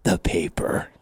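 low, whispered threat; menacing choker. Acted. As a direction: whisper it low while squeezing him in a chokehold. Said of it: "starts squeezing, goes".

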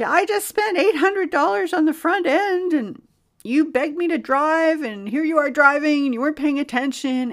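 high, loud scolding; angry sister. Retold or imitated. Sister scolds brother for crashing her car, loud angry. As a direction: berate him like a furious sister.

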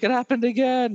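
high theatrical "It happened again" after transforming; post-werewolf lament. Pretend. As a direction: wail it like a cursed werewolf. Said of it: "werewolf transformation. Be like".

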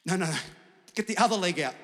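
ordinary talk

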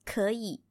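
可以 with 可 rising, 以 low; 可以 with the tone change on 可; second then third tone. Shown as a demonstration. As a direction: say 可以 as a rising tone into a dipping one. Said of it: "we say second tone and the third tone".

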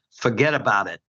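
put-on Brooklyn accent. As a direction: say it in your best Brooklyn accent. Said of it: "do my best Brooklyn accent here".

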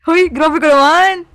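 loud, high, drawn-out objection; mock-offended protest. Real, not acted. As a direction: call out your friend in mock outrage. Mock protest at friend's teasing. (kunwaring nagrereklamo nang malakas)

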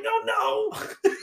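high pleading no; mock dismay; despairing. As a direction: cry out as they abandon you. Friends leaving him alone.

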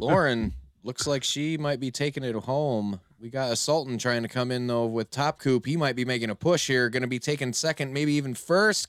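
fast race call rising to a high shout; frantic mock sports commentary. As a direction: call the finish, building to a yell. Live race call building to shout.

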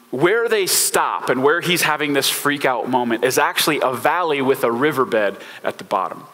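ordinary talk, nothing marked

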